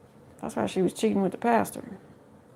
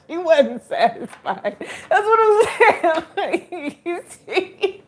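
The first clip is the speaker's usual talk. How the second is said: laughing; 9 semitones higher, 4 dB louder, much slower than usual. Real, not acted. Giggling over the joke.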